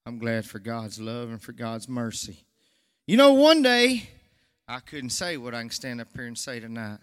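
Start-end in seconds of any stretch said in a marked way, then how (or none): 3.1-4.1 sudden high, loud preaching burst; a preacher's rising shout. Real, not acted. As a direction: lift it out to the congregation. Preacher bursts out mid-testimony.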